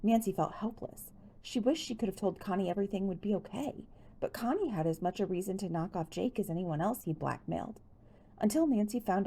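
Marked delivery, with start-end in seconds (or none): none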